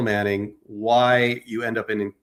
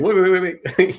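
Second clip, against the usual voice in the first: higher, louder string of waits; urgent interjection; 9 semitones higher, 6 dB louder than usual. Retold or imitated. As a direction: cut in eagerly to halt someone. Acting out a philosopher objecting.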